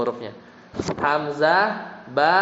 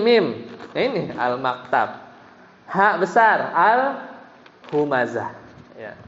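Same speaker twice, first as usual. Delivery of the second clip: ordinary talk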